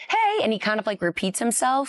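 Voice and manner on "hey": high, bright-energy "Hey"; mock greeting way up high; imitating Tanner's hey. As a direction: pop the "Hey" up high like an excitable friend. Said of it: "he'll be like".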